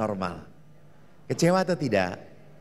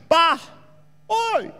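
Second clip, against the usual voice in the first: calling out; 4 dB louder than usual. Acted. Child calling father down well.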